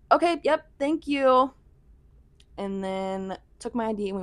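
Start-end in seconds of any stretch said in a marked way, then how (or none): 0.1-1.5 fake polite. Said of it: "I was also pissed".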